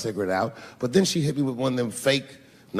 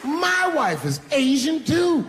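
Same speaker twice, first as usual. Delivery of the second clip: gleeful taunt; 12 semitones higher, slower than usual. Acted. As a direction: shout the gotcha at her. Taunting punchline, shouted.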